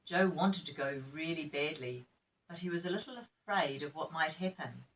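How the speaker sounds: plain reading voice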